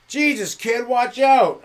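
high, loud startled yell; yelling truck driver. Retold or imitated. Garbage truck driver yelling at the kid on the tricycle. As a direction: bark it out the window at a kid. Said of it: "The guy sticks his head out the window like".

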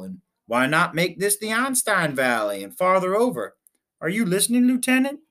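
a captain carried away with his own ideas; enthusiastic captain. Acted.

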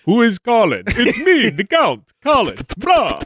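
high hammed-up vampire count. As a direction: play the count, high and hammy. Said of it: "how about Count Colin Lestrade".

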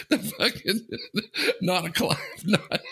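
high, broken words between laughs; real giggling fit, barely getting words out; talking through laughter. Real, not acted. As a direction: try to speak while cracking up.

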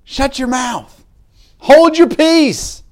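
high, sharp mock scolding, slowing down; hushing crowd. Crowd silencing the blind men, high slow scolding. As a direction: shush them like an irritated crowd. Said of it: "And the crowd goes".

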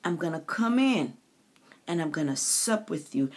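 preaching God's promise, building in force; God's promise, preached. Acted. Preacher voicing God's promise to the listener, building up. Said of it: "And he said".

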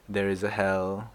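ordinary delivery

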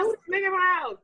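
mock surprise. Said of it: "I was like".

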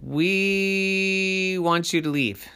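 high, very drawn-out monster chorus; monsters' demand. A narrator's reading. Monsters telling the people to go, drawn-out demand.

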